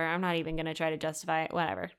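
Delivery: usual speaking voice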